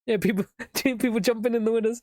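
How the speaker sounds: ordinary delivery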